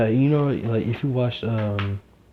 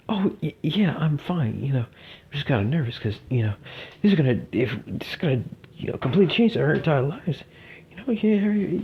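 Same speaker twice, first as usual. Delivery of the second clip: higher, jittery schoolgirl voice imitating Uraraka; nervous girl voice; 6 semitones higher than usual. Teller voicing nervous Uraraka before the exam, high and jittery. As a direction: voice her nervous and high, stumbling.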